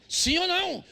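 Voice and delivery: insistent demand. Real, not acted. Preacher demanding an answer from the congregation, insistent. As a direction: press the crowd hard for an answer.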